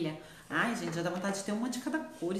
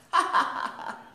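airless, whispered little laugh; breathy laugh; 9 semitones higher than usual. Real, not acted. Host laughing about practicing eyeliner, breathy. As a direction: laugh under your breath.

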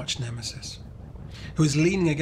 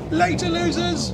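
much higher and louder mocking yell; taunting shout; 6 dB louder than usual. A narrator's reading. Gavin jeers at the kids as he pedals off, shouting. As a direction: jeer it back over your shoulder.

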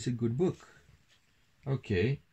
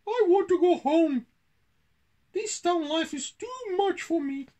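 very high mouse voice, starting loud; Pippin's squeak; 6 dB louder than usual. A narrator's reading. Country mouse wanting home after the cat, squeaky complaint. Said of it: "said Pippin".

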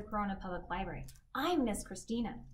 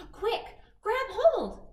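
high loud slow call; 12 semitones higher, 8 dB louder, slower than usual. Acted. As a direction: call out to grab on. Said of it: "called Bluebell".

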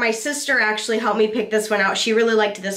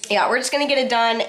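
ordinary talk

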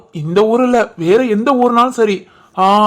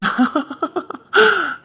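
soft laugh; 6 semitones higher, 4 dB quieter than usual. Real, not acted. Laughing continues.

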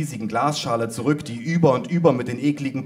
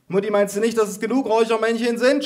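high, raised-voice complaint; mother yelling; 10 semitones higher, 4 dB louder than usual. A narrator's reading. Mother to grandma through incense smoke, high yelling. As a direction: call it out high across the room. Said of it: "schrie meine Mutter".

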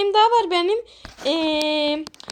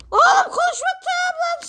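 high annoyed shout; 10 semitones higher than usual. Acted.

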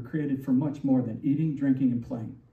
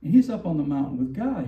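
ordinary talk, nothing marked

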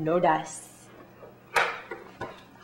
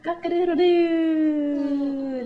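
slow, higher rooster crow; rooster call; 7 semitones higher, slower than usual. Shown as a demonstration. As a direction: crow it out slowly. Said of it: "What does the rooster say?".

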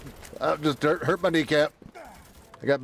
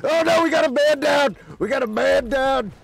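alarmed shout; 7 semitones higher than usual. Acted. Alarm over downed teammate.

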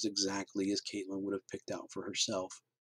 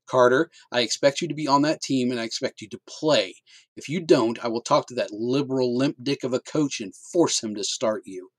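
stern command; 4 semitones higher, 7 dB louder than usual. Acted. Father ordering son.

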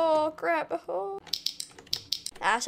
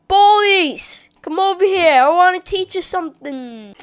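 raised, loud mock challenge; taunting call-out; 5 semitones higher, 9 dB louder than usual. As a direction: call the enemies over for a fight. Calls out enemies to fight.

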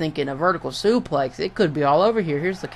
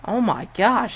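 ordinary delivery

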